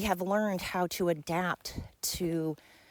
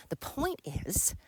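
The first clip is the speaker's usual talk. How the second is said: slow whispered aside; 5 dB quieter, slower than usual. Real, not acted. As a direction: drop to a whisper to make the point. Whispered aside mid-story.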